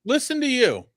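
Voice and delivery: "Listen to you" squeaked high and mocking; mocking high retort. Teasing his cohost with a squeaky mock retort. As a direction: throw it back in a high mocking voice. Mocking back-and-forth insults.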